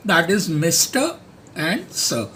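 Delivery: ordinary talk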